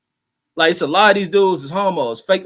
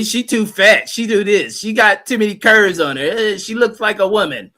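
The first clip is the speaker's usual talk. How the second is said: higher-pitched mock griping; mock complaint; 5 semitones higher than usual. Host mimics men picking at women's looks, mock complaint.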